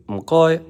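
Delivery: "Mkoy" said high and loud with a Cantonese tone; Cantonese m goi. Shown as a demonstration. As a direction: pitch "Mkoy" up high, a crisp Cantonese syllable pair. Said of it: "they say".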